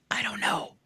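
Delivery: breathy whispered shrug. Real, not acted. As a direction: drop to a whisper. Whispered aside.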